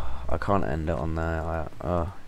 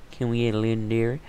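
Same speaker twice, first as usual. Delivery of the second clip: quick repeat; 3 semitones higher, much faster than usual. Real, not acted. Gamer repeating the question to himself, quick and plain. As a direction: rattle it off fast and even.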